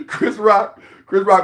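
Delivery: name spoken through a laugh. Real, not acted. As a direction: still laughing as he says it.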